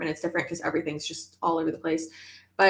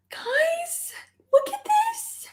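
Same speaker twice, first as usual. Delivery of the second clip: squealing way up high to the viewers; excited squeal; much slower than usual. Real, not acted. Showing the cute outfit to her viewers, high excited squeal.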